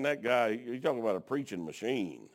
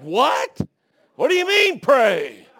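high, loud alarmed squawk, laughing through it; a preacher yelping in terror at the pilot; panicked disbelief; 11 semitones higher, 14 dB louder, slower than usual. Retold or imitated. Preacher panicking at the pilot, high loud alarmed yelp.

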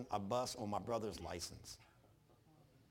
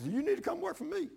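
much higher put-on Jack voice; imitating Jack; 4 dB louder than usual. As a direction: play the eager friend, pitched way up. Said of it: "He's gotta rub people's backs".

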